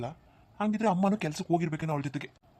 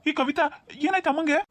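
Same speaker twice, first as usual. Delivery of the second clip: alarmed; 10 semitones higher, 3 dB louder than usual. Acted. Son alarmed about his mother.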